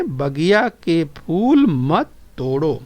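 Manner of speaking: slow, even repeat of the line; slow recitation for the class. Shown as a demonstration. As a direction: say it again slowly with them. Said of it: "बोलिए".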